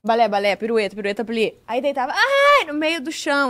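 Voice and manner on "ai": high, loud mock yelp of pain; cry of pain. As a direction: yelp "ai" as if collapsing in agony. Retelling friend collapsing in pain. (grito agudo de dor, imitando)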